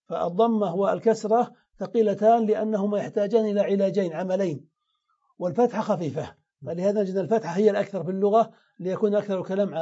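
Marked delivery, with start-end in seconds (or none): none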